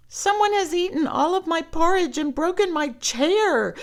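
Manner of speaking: high, loud little bear voice; baby bear, high and loud, complaining about the porridge; small bear voice. Acted. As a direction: squeak it out as the upset baby bear. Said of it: "said the smallest bear".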